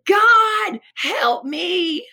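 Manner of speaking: a high, loud cry to God; desperate scream. Retold or imitated. A junkie hiding from the cops screams to God for help. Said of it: "I screamed".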